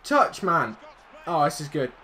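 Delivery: normal talking voice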